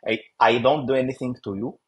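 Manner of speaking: usual speaking voice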